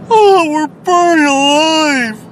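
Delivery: high slow screaming cry; burning scream. Acted. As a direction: wail like you're on fire. Skit crash, burning.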